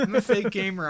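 laughing. Real, not acted. Laughing admission after teasing.

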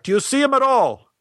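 high-pitched call back; yelling back. Retold or imitated. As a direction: imitate a director calling from the seats. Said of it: "Stein yelled back".

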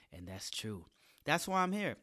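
normal talking voice

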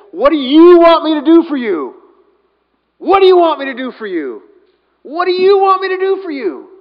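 loud, high, ringing question; emphatic calling out. Acted. Jesus asking the blind beggar, emphatic calling out. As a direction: throw the question out at full voice. Acts Jesus addressing Bartimaeus emphatically, three times.

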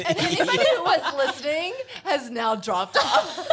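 high, slow, through laughter; real laughter in the words; laughing. Real, not acted. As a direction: crack up while saying it. Laughing after the singing.

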